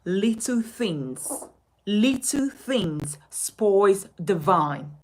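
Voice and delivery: saying it slowly, each word weighed; slow and deliberate. Shown as a demonstration. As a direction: draw the proverb out slowly. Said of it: "I'm going to begin by saying".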